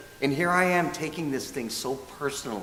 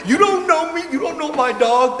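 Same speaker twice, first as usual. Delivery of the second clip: high, loud mock-outraged outburst; indignant retort; 13 semitones higher, 7 dB louder than usual. Man snapping at an old woman over his dog, indignant. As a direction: throw it back as a pitched-up indignant yell.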